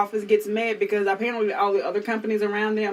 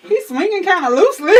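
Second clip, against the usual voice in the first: laughing; 7 semitones higher, 7 dB louder than usual. Retold or imitated. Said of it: "I was like".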